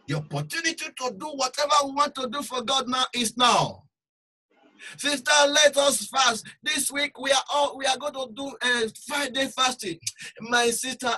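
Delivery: loud, high preaching cry; forceful preaching. Acted. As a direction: drive the warning home.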